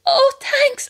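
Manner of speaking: high chirpy thanks; imitating two girls at the door; mock posh thanks. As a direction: squeak it out sweetly.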